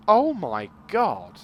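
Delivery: slow, loud disbelief; stunned exclamation. Real, not acted. Reacting to the cannon count, slow loud disbelief. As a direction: draw it out, floored.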